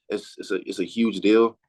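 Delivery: ordinary delivery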